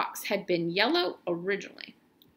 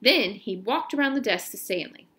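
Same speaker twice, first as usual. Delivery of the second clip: ordinary delivery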